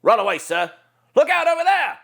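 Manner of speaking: high, urgent trooper warning; high-pitched alarmed clone trooper; generic clone. Shown as a demonstration. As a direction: warn the squad fast. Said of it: "the generic clone".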